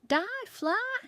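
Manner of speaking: high-pitched battle cry; yelled attack. A narrator's reading. Baker attacking a fly with newspaper, yelling. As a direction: cry it out as you strike. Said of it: "she yelled".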